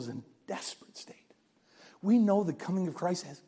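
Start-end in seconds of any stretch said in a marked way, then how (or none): 0.0-1.3 hushed preacher's whisper. Real, not acted. Preacher whispering to the congregation, grave whisper.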